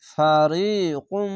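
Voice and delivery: ফারিকুম slowly with the final ম hummed; nasal ghunnah. Shown as a demonstration. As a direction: recite ফারিকুম, humming the ম. (গুন্নাসহ ফারিকুম)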